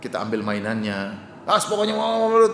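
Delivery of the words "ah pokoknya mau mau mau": put-on higher, insistent child's whine; whiny child. Child refusing to give up the toy, higher whine. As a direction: whine like a stubborn kid.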